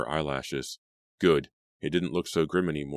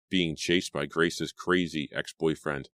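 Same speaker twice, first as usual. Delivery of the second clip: ordinary delivery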